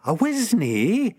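ऊँचे सुर में विरोध; झटके से इनकार करना; protesting. Acted. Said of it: "I protested".

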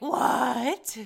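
quoting James, stunned, yelling What; mock shocked yell. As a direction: blurt out in disbelief. James shocked outburst quoted.

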